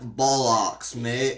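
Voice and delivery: mock Toby Kebbell badly faking British; British accent. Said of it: "He's like".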